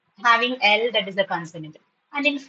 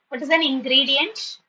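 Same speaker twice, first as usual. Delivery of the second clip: ordinary talk, nothing marked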